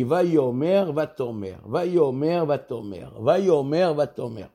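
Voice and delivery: Vayomer monte, Vatomer retombe plus bas et plus doux; montante puis descendante. Shown as a demonstration. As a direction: alterner Vayomer montant et Vatomer tombant, comme une cantillation. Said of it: "le terme employé et l'intonation". (Vayomer chanté vers le haut, Vatomer répond en descendant)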